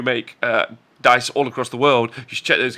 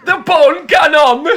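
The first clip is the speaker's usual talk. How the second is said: higher, hammed-up Australian voice, laughing; Australian accent; 12 semitones higher, 5 dB louder than usual. Answering his co-host's teasing in a laughing, higher, hammed-up Australian accent. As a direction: put on a silly Australian twang for the bone cannon. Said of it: "This is how we talk".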